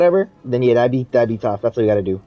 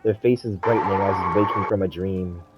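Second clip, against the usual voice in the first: story narration; 6 semitones lower, 7 dB quieter than usual. Acted. Reads a story aloud.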